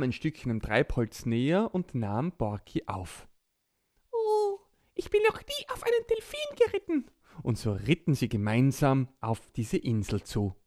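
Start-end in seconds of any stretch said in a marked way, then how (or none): 4.1-7.1 high squeaky little creature voice; small child voice. Acted. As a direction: speak like a tiny excited child. Borki rides the dolphin.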